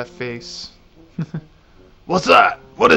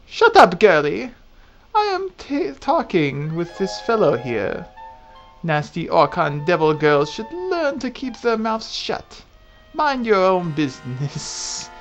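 put-on angry old lady; 2 semitones higher than usual. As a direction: scold like a cranky crone. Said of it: "I get to do an old lady voice".

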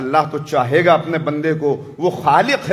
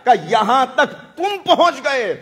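preaching at full force; 6 semitones higher than usual. Real, not acted. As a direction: cry out the devotion of arriving at the shrine. Preacher rising to a climax.

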